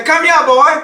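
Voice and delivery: put-on loud, high Gullah Geechee call of "Come here, boy", stress on "here". Shown as a demonstration. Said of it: "They would say".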